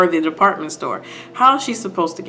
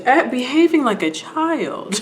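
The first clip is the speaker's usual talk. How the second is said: higher, slow condescension; mock scolding; 5 semitones higher, 3 dB quieter than usual. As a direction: imitate a lady chiding a child. Lady scolds crying Marsha.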